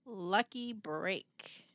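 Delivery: dry aside. A narrator's reading.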